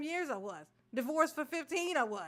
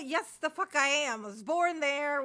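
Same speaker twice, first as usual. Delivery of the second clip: ordinary delivery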